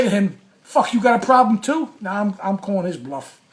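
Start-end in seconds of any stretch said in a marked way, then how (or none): 0.6-1.9 louder, higher retold challenge, chest puffed; mock challenge. To the cameraman who threw him, a loud mock challenge. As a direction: throw down the dare louder and higher.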